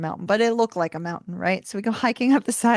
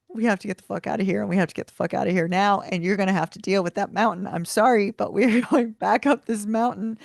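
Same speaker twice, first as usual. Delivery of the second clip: fast, urgent retelling of his panic; panicked rush; faster than usual. Retold or imitated. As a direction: rattle it off like he's scared. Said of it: "And he's like".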